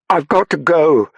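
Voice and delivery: high, loud, drawn-out shout; shouting over noise. A narrator's reading. Saffron leaving amid catcalls, shouting over the noise. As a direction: make yourself heard over the din. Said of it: "Saffron shouted over the noise".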